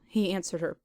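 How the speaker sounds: normal talking voice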